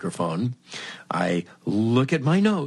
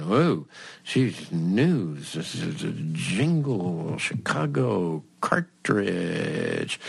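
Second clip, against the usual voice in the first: slow note-taking; 3 semitones lower, much slower than usual. Retold or imitated. Reading back his notes.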